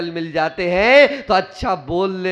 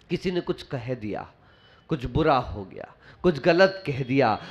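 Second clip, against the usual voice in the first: ordinary talk, nothing marked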